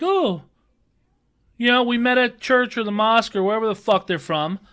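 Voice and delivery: high, sing-song mock of chatty shoppers; mocking chit-chatters. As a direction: ape the aisle-blockers in a high voice.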